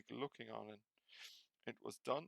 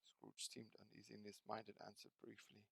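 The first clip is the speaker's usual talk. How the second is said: plain reading voice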